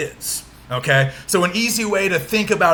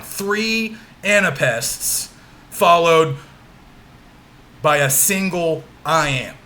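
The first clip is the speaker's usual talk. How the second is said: slowly dictated, with long gaps between phrases; slow dictation; slower than usual. Real, not acted. Teacher repeating the line's meter for note-takers, slow dictation. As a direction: leave time for writing between phrases.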